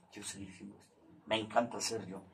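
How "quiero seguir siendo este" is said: quiet repeat. Real, not acted. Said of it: "repeats his own phrase".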